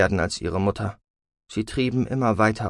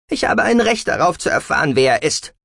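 loud, raised, insistent voice; indignant daughter claiming her right; angry demand; 7 semitones higher, 6 dB louder than usual. A narrator's reading. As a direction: stand your ground hotly.

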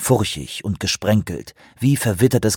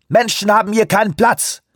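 high, raised preaching; loud invocation; 12 semitones higher than usual. A narrator's reading. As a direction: finish the sermon with force. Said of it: "hob beschwörend die Arme". (beschwörend, erhoben)

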